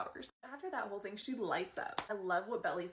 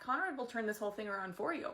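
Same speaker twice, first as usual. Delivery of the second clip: usual speaking voice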